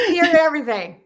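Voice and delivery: real laughing speech. Real, not acted. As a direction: talk while still cracking up. Still laughing after the punchline.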